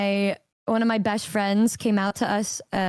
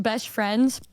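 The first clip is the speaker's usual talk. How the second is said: normal talking voice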